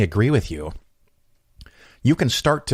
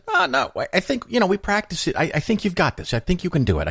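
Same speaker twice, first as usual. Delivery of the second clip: retold encouragement; 11 semitones higher than usual. Talking a nervous joke teller into it, high coaxing encouragement. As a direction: start way up high, coaxing, then ease down.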